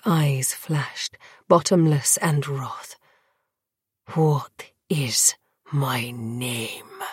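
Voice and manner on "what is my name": slow fierce whispered demand; whisper yelling. Acted. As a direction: hiss it, slow and furious. Vampire demands again, wroth.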